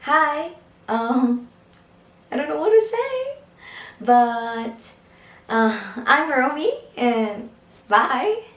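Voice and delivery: awkward demo full of ums and pauses; halting self-introduction, acted out; hesitant, filler-filled. Shown as a demonstration. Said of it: "it also includes fillers such as uhs and ums".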